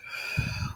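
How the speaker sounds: long, soft audible breath; breathing exercise exhale. Shown as a demonstration. Mom showing her children how to relax, slow exhale. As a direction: demonstrate a calming breath out. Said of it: "breathe with your nose".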